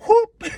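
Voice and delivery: high joyful whoop; excited whoop. Real, not acted. Fan whooping at the reveal. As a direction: let out a hype whoop.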